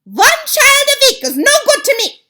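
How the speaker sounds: very high, loud, scornful screech; a witch queen scolds her coven; witch cries out. Acted. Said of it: "cried out".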